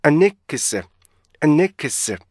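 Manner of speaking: first half of "unexpected" joined as a-ne-ki-se; linked syllables. Shown as a demonstration. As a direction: run n into the vowel, unbroken. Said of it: "이렇게요". ("unexpected" 앞부분을 아니크스로 이어서)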